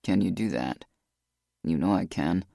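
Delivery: deep and subdued; low, heavy male voice. A narrator's reading.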